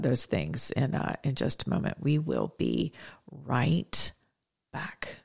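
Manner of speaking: soft whispered sign-off. Real, not acted. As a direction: drop to a whisper on back. Signing off before the break.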